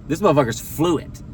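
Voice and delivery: usual speaking voice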